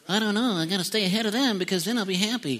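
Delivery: lightly voiced worried chaser of stuff, mocking tone; mocking anxious people. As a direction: mimic a nervous go-getter. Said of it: "other people going".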